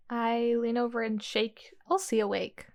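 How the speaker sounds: normal talking voice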